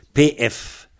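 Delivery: ordinary talk, nothing marked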